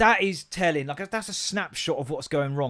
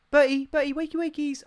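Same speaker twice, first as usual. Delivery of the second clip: much higher, gentle sing-song calling; sing-song wake-up; 12 semitones higher, 3 dB louder than usual. Retold or imitated. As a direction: coax a sleepy toddler awake. Waking daughter gently.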